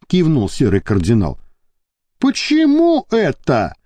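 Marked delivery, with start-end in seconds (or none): 2.2-3.7 much higher, slow cocky challenge; huffy indignant question; bristling. A narrator's reading. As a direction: puff up and demand. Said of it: "запетушился Иван".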